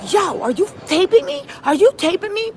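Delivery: high, indignant imitated questioning; mock accusation. Retelling him flipping it around on her, mock accusation. Acts his accusation of being taped.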